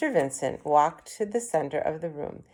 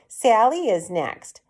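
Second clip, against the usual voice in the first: called out, insisting; 5 semitones higher, 6 dB louder, slower than usual. A narrator's reading. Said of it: "another mother called".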